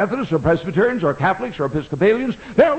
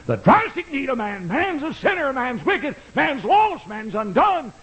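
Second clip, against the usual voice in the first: fervent proclaiming; 9 semitones higher than usual. Acted. Preacher's fervent exclamation.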